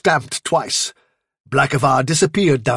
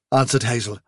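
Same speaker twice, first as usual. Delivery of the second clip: usual speaking voice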